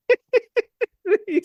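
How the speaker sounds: squealing high laugh; shrieking giggle. Real, not acted. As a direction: burst into high-pitched laughter. Laughing at the punchline.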